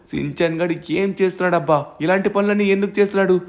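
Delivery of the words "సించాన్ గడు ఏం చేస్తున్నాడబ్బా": ordinary talk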